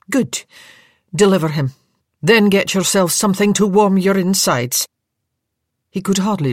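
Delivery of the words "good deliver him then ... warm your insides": higher, louder Scottish brisk kindness; Scottish nurse sending the bearer off to get warm; Scottish orders. Acted. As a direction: dismiss the bearer briskly in Scots. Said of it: "a Scottish voice".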